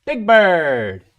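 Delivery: loud, high Big Bird. Pretend. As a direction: boom it out as the big yellow bird. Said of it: "play a Sesame Street".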